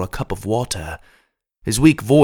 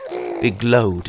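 ordinary delivery, nothing marked

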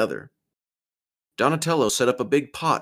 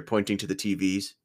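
ordinary delivery, nothing marked

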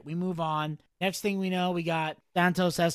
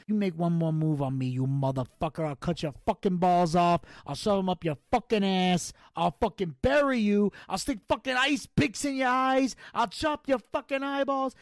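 De Niro style threat; 3 semitones higher than usual. Retold or imitated. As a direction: growl it like a gangster then climb in pitch. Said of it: "And he goes".

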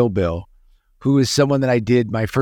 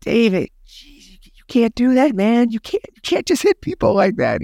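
much higher, panicky plea; retold alarmed protest; 12 semitones higher than usual. Young actor pleading with Carradine after the slap, high alarmed. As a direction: scold a friend in a nervous rush. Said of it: "And I'm like".

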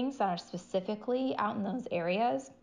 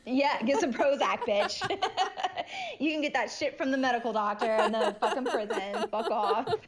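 laughing; 5 semitones higher than usual. Real, not acted. Echoing the jeer while laughing.

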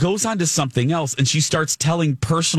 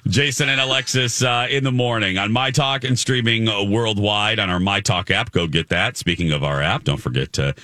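low-pitched, even station promo; radio host reading out the show plug; 7 semitones lower than usual. Real, not acted. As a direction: close the segment with a lower-voiced plug. Station promo after the chat.

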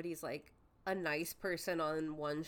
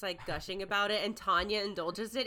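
normal talking voice

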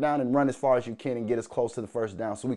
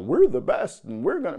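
mock fan boast; 5 semitones higher, 4 dB louder than usual. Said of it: "start talking about".

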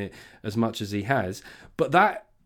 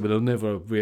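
smug mimicry. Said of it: "as they were fapping themselves to death over that phrase".

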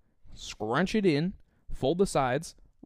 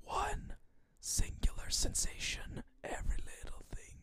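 spooky whisper; 12 dB quieter than usual. Acted. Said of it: "in a spooky voice".

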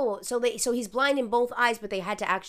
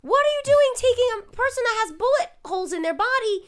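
outraged yell; 14 semitones higher, 8 dB louder than usual. Real, not acted. Outraged at cops.

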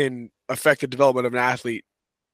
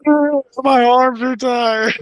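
put-on very high, very slow, louder childish whine; whiny kid; 12 semitones higher, 7 dB louder, much slower than usual. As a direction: stretch it out like a little boy begging to stop. Boy complaining again, retold mockingly.

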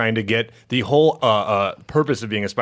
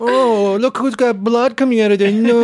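high, louder mocking baby voice; baby talk; 10 semitones higher, 4 dB louder than usual. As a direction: coo it like to a toddler.

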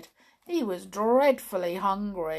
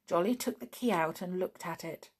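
plain reading voice

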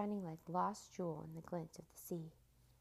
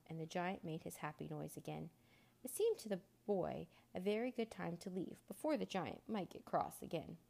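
usual speaking voice

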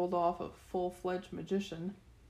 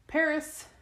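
high, loud summoning call; 9 semitones higher, 5 dB louder than usual. A narrator's reading. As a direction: call out the destination to the heavens. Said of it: "she called".

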